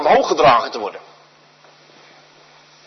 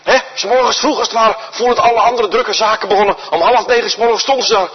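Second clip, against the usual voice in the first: ordinary talk, nothing marked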